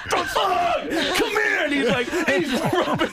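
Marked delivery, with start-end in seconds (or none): 0.1-1.6 high, shouted rough-handed mom; mock-furious mom yelling at her kid; ultra violent mom. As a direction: yell it like a manhandling mother. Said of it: "like a really ultra violent mom".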